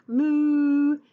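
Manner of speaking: loud drawn-out moo; cow moo. Acted. Teacher voicing the cows with a long loud moo.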